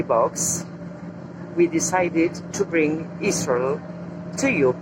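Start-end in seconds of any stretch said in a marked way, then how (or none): none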